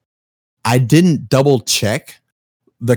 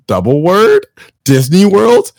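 excited disbelief; 7 semitones higher, 5 dB louder, slower than usual. Retold or imitated. Laughing disbelief retold.

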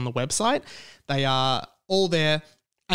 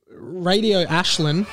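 announcer call; 4 semitones higher, slower than usual. Acted. Calling out listener name.

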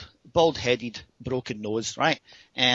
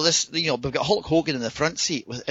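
ordinary talk

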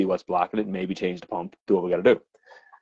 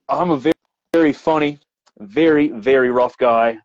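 ordinary talk, nothing marked

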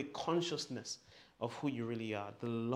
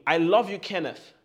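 loud declaration; 2 semitones higher, 8 dB louder than usual. Shown as a demonstration. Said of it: "So say this with me".

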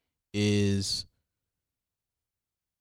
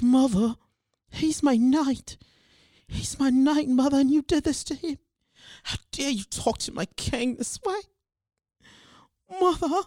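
dramatic cool voice; 4 dB louder than usual. Pretend. Daughter defending her knight to mom, high theatrical voice. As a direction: proclaim like a stage heroine. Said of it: "get a cool voice like".

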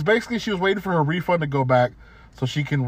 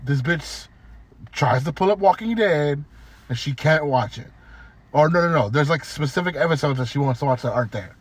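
usual speaking voice

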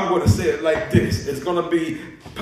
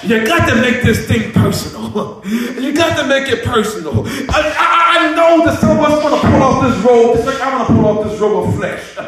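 high, forceful cry of longing; impassioned preaching; 6 semitones higher, 6 dB louder than usual. Real, not acted. Preacher longing to see Jesus, high impassioned preaching. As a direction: preach it at full pitch.

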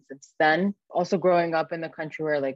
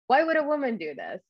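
high-pitched mock-incredulous question; incredulous quote; 9 semitones higher than usual. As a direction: imitate someone baffled and disapproving. Quoting sexist question said to her.

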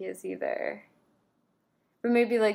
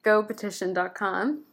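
ordinary talk

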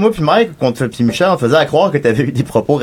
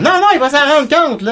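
mocking high voice; 12 semitones higher than usual. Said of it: "t'es comme".

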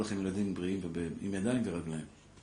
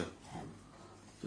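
slow whispered aside; 19 dB quieter, much slower than usual. Real, not acted. Aside in a sensitive confession.

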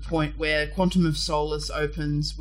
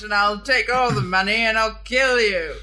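hammy cartoon villain voice, higher and louder; mustache twirling villain; 6 semitones higher, 7 dB louder than usual. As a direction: gloat like a cheesy Bond villain. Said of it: "Mustache twirling villains are like".

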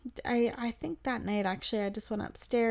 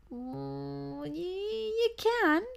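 very high, drawn-out prodding; mock coaxing; 7 semitones higher, much slower than usual. Pressing a secretive companion to tell, high drawn-out coaxing. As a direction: stretch it upward into a squeaky insistence.

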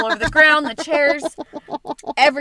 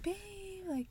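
mock sleepy husband; 8 semitones higher, 17 dB quieter than usual. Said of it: "wake you up and be like".

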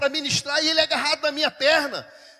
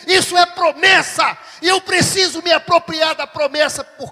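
loud, emphatic preacher pounding each phrase; full-force preaching; 3 semitones higher, 7 dB louder than usual. Acted. Pastor preaching grace to the church, loud and fervent. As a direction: preach it out to the congregation.

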